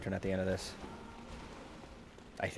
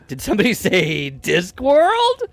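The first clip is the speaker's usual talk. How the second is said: slow, higher, louder announcer; 8 semitones higher, 8 dB louder, slower than usual. Pretend. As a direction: boom it out like a game show host. Joking reply to a chat remark.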